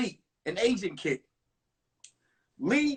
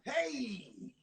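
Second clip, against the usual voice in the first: inner cool hey; 11 dB quieter than usual. Retold or imitated. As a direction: say "Hey" softly to yourself, playing it cool. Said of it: "in my mind".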